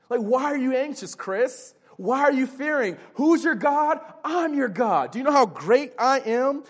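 high, impassioned voice of God; God encouraging his people. Acted. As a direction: reassure insistently, challenging every fear. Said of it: "This is God encouraging his people".

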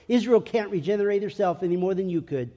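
ordinary talk, nothing marked

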